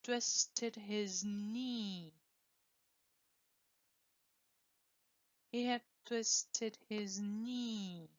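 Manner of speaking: sentence read very slowly for repeating; slow drill. Shown as a demonstration. Teacher slowly reads it out for students to repeat. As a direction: slowly model the sentence word by word. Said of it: "You repeat after me".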